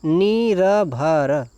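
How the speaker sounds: निरभर stretched very slowly, each syllable apart. Shown as a demonstration. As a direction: drag out निरभर for students. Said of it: "निर्भर यहाँ पर सही है".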